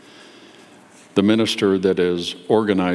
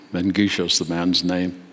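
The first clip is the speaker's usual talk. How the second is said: usual speaking voice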